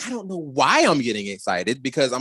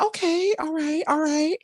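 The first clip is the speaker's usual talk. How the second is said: much higher, pleasantly surprised murmur; intrigued approval; 12 semitones higher than usual. Retold or imitated. Host replaying reaction to the promo, high intrigued approval. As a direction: lift it way up as if won over.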